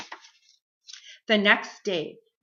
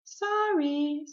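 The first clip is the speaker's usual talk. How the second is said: higher, sing-song feigned puzzlement; pretending not to hear; 9 semitones higher, 4 dB louder than usual. A narrator's reading. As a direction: play innocently hard of hearing. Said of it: "putting her hand to her ear".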